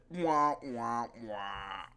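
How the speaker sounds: mock sob sound; mock crying wah. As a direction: fake baby crying, trailing off. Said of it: "It's like".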